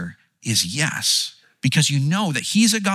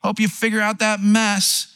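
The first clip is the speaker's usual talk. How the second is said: mock dismissive call; 7 semitones higher than usual. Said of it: "kind of shouting down like".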